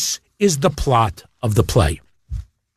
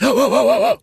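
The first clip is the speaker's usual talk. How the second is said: quick startled intake of breath; sharp gasp; 8 semitones higher than usual. Retold or imitated. Showing how the toupee moment looked, a quick startled gasp. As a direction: gasp as if jaw-dropped. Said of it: "like that".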